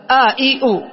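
آ، إي، أو as a, i, u, each vowel separate; three hamza vowels. Shown as a demonstration. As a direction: sound out آ، إي، أو one vowel at a time. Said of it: "الهمزة مثلثة".

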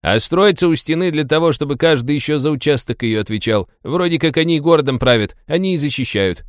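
high-pitched driver voice, explaining; chatty taxi driver, informative. Acted. Taxi driver explains the local bosses to passengers, chatty and high.

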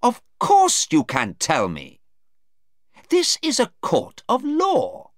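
high, forceful courtroom outrage; very angry. A narrator's reading. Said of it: "Mister Cheng asked very angrily".

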